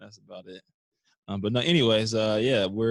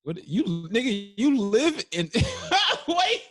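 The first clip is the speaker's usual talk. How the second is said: laughing disbelief; 7 dB louder, slower than usual. Real, not acted. Teasing friend about vacation.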